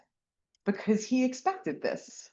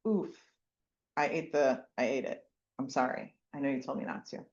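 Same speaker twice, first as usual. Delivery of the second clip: put-on lower, softer sorry child; sheepish confession; 7 semitones lower, 7 dB quieter, faster than usual. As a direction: own up quietly. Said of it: "Just say".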